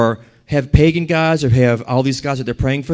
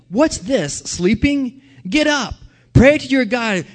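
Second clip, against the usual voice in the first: higher, urgent captain's voice; captain rousing Jonah; 6 semitones higher, slower than usual. A narrator's reading. Captain waking Jonah in the storm, urgent and high. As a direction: shake him awake, pitched up. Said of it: "The captain came to him and said".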